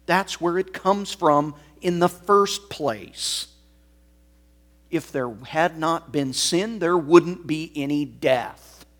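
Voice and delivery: booming sermon voice; full-force preaching. Acted. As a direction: thunder it from the pulpit.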